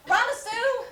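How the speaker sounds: calling out. Acted. Calling out to another woman.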